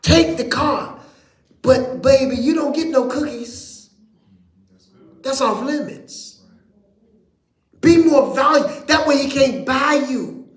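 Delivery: high, loud preacher's call; full-force preaching. Acted. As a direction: preach it up to the rafters.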